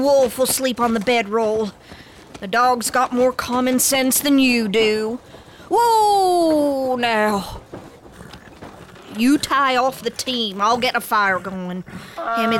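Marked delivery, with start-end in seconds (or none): none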